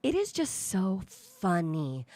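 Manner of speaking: slow, drawn-out condescension; mocking quote. Co-host quoting athlete demeaning female reporter, mocking. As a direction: stretch it out like a smug put-down. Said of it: "he said".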